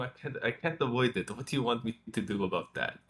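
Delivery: ordinary talk, nothing marked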